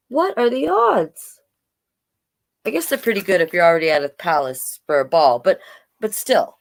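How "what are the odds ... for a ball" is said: ordinary delivery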